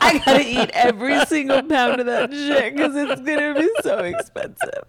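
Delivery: high, laughing and crying; laughing panic. Retold or imitated. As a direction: laugh till it squeaks. Retold own panic, laughing.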